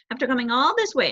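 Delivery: ordinary delivery, nothing marked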